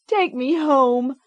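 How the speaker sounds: put-on high, slow pleading child; begging child. A narrator's reading. Girl begs her mother to end it, slow plea. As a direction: plead with your mother, slowly.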